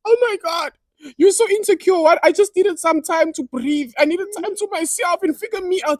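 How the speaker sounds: high-pitched mocking girlfriend voice; exasperated girlfriend. Girlfriend answering his questioning, high exasperated imitation.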